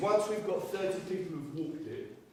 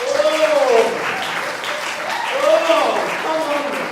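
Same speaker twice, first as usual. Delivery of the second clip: loud, higher, slow come-on-then; mock challenge; 6 semitones higher, 11 dB louder, much slower than usual. Comedian to the bank, loudly squaring up. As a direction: roar it like squaring up for a fight. Fired up for a fight.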